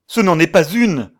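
loud, high, forceful contradiction; vehement retort. A narrator's reading. Man insisting to his doubting friend, vehement shout. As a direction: fire back with vehemence. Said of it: "répondit Trenherne avec véhémence".

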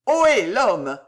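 loud, annoyed hail; irritated shout. A narrator's reading. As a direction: call the man back crossly. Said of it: "cria monsieur Pickwick, irrité". (interpellation criée, irritée)

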